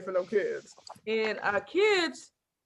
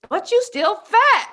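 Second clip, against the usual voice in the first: put-on high, loud, slow child jab; little niece; 10 semitones higher, 8 dB louder, slower than usual. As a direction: deliver the niece's blunt line high and slow. Niece voiced in story.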